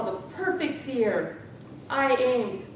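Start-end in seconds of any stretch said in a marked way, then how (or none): none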